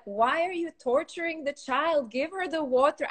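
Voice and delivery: mock the husband's protest; a hint of an exasperated husband; husband's complaint. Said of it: "He goes like".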